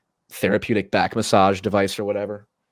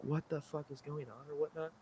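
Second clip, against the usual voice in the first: baffled inner thought; 5 semitones higher, 16 dB quieter than usual. Retold or imitated. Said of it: "I'm just sitting there like".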